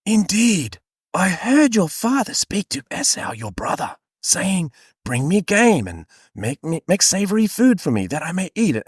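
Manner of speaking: high-pitched theatrical Bible mother; conspiratorial, stagey reading; whispery scheming voice. Acted. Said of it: "in a whispery voice at the moment".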